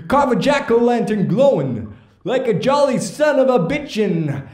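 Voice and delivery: theatrical celebrity impression, swooping pitch; Christopher Walken. Slam poet reading his Halloween poem, Christopher Walken impression. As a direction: recite it as a mock-dramatic poem in an impression. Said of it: "read it in my Christopher Walken voice".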